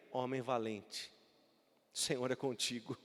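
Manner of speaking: quiet, hushed angel's greeting; soft angel's words. Acted. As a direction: say it hushed and low, almost to yourself. Angel's words, much softer.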